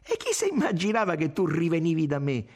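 high-pitched, impassioned self-defence; vibrant retort. A narrator's reading. Irene defends talking to men, voice vibrant. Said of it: "disse vibrante Irene".

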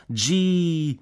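high, drawn-out cry; soldiers' cry of wonder; shouted exclamation. A narrator's reading. (grido acuto di meraviglia)

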